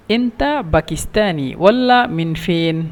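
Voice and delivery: slow, careful teaching repeat; each word drawn out for learners; slow demo. Shown as a demonstration. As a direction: spell it out slowly for the class.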